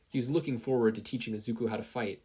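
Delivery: usual speaking voice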